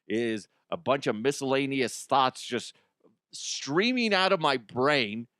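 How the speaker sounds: ordinary talk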